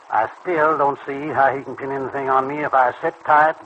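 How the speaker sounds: ordinary talk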